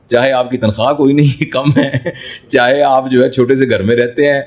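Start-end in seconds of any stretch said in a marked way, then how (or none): none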